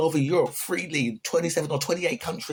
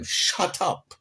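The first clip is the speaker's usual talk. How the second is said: curt dismissal; 4 semitones higher than usual. Acted. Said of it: "That's what I say to them".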